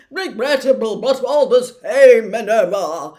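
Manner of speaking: loud minister. Acted. Minister justifying himself to McGonagall, loudly. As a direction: boom it out like an affronted minister. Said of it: "was saying loudly".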